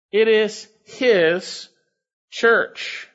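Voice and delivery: slow, stress on his and church; emphatic. Acted. Preacher stressing ownership.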